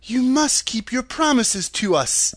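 put-on lifted girlish exclamation; exclaiming girl. A narrator's reading. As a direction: demand it as an indignant young heroine. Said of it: "exclaimed Dorothy".

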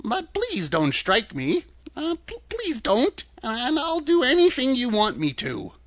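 high, stammering plea; timid, faltering, high old-man voice; trembling voice. A narrator's reading. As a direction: beg for mercy as a frightened little man. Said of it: "said the little man in a trembling voice".

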